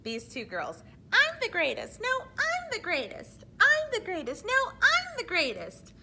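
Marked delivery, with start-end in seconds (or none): 1.1-5.9 high, loud bratty boasting; one-up each other like spoiled divas; squabbling divas. Acted. Teacher voicing two cartoon opera singers, bickering high and loud.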